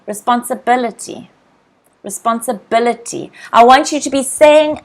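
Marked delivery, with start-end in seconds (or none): none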